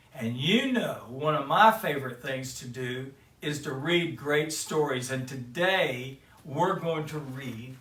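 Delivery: ordinary delivery, nothing marked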